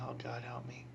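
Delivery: near-whisper under the breath; hushed, retold muttered prayer. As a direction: whisper a dreading plea to God.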